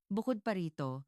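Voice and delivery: ordinary delivery, nothing marked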